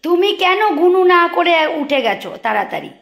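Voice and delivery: high, loud mock scolding of a pet; pretend-scolding the pet for getting up too fast. Real, not acted. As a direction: scold the little one in a high sing-song. Scolding pet in baby talk.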